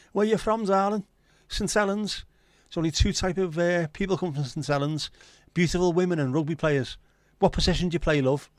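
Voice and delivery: high, loud Scouse bingo caller patter. Retold or imitated. Retelling the Peter Kay joke, hammed-up Scouse bingo caller. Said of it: "when he was the Scouse bingo caller".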